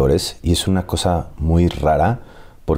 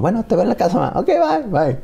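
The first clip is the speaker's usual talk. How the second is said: high, bouncy goodbye sing-song; playful high-pitched farewell to mom; singsong goodbye. Retold or imitated. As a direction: chirp a breezy see-you-at-home. Casual goodbye to mom on set.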